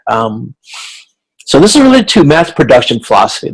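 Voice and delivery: normal talking voice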